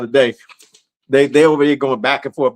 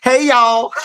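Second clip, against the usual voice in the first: Southern drawl; 7 semitones higher than usual. Retold or imitated. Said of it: "Sherry talks Southern".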